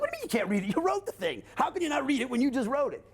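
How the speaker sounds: high-pitched baffled objection; mock incredulity. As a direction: imitate a listener who can't believe it. Acts out a baffled listener.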